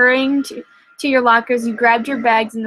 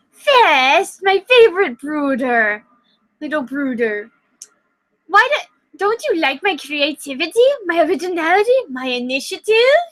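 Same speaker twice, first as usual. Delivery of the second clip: higher, wheedling sing-song; cooing; 4 semitones higher than usual. Acted. Gilbert cooing at Ludwig, wheedling and higher. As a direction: coo at your little brother. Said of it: "Gilbert cooed".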